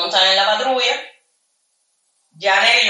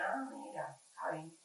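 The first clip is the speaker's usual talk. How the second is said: unvoiced whisper; 4 semitones lower, 23 dB quieter than usual. Answering the interrogator, whispered. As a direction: let it out under your breath.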